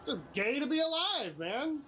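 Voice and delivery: high-pitched mock bliss; very sarcastic. Winding up a bully, very sarcastic. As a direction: overdo the joy in a high voice. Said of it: "very sarcastic with people".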